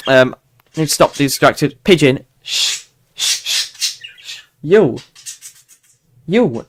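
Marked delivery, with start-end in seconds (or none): 2.4-4.4 repeated hissed shush; drawn-out "shh". Real, not acted. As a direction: hush a noisy pigeon. Hushing a noisy pigeon.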